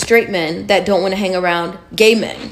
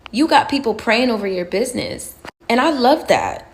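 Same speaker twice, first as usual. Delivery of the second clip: usual speaking voice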